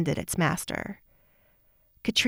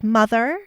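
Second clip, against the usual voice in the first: Mother leaned on, louder with a mocking rise; Mother said pointedly, louder, rising; emphasis on mother; 4 semitones higher, 6 dB louder than usual. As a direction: stress Mother to get under her skin. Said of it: "add emphasis to the word mother".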